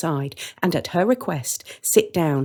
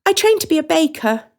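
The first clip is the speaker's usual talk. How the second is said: much higher, sing-song reassurance; the woman's voice; 12 semitones higher, 5 dB louder than usual. Acted. The woman welcoming the children, high and sweet. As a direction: brush it off sweetly. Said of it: "says the woman".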